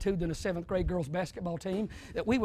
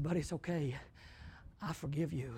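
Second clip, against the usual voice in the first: much softer, low tender reassurance; gentle forgiveness; 6 semitones lower, 13 dB quieter than usual. Retold or imitated. Brother Nick kneeling, gently forgiving the crying boy, soft and low. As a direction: soothe the crying boy softly.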